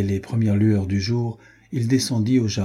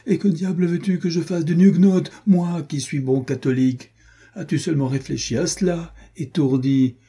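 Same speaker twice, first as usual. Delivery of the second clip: higher-pitched, exasperated protest; indignant scolding; 7 semitones higher than usual. A narrator's reading. Renaud chides his wounded friend over a Huguenot bride, indignant scolding. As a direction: scold him in lively indignation.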